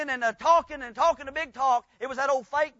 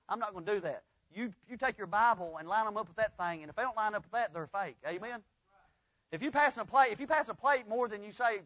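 usual speaking voice

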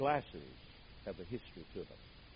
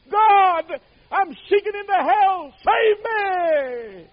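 much higher, louder terrified scream; 23 dB louder, much slower than usual. Retold or imitated. Man clutching the post cries out to God, a terrified scream. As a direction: shriek for rescue from hell. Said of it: "seized the post and screamed".

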